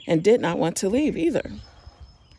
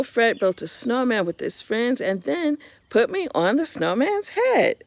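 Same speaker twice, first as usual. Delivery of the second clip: ordinary delivery, nothing marked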